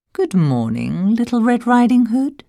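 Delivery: a lightly sly, smooth wolf; soft, oily wolf voice. A narrator's reading. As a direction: purr it like a sweet-talking wolf. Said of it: "said the wolf in a soft, oily way".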